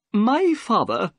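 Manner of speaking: high, slow storyteller in character; nervous little man. A narrator's reading. Little man begins his strange story to guests, nervous. Figure of Thaddeus Sholto.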